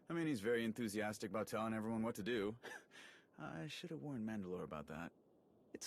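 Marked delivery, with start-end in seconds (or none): 2.6-3.1 short quiet chuckle; soft laugh. Acted. Ras laughs about Jakaya bossing everyone, soft chuckle. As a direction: let a small amused laugh slip out.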